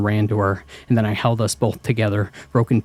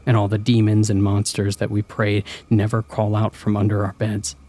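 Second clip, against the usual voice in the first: ordinary delivery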